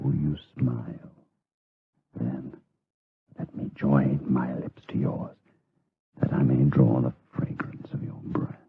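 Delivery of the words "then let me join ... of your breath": breathy whisper close to her face; hushed lover's whisper; seductive whisper. Acted. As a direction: whisper it as if not to wake her. Seducing the drugged sleeping woman.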